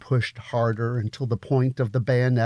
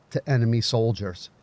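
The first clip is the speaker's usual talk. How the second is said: ordinary delivery, nothing marked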